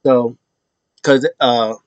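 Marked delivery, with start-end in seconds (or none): none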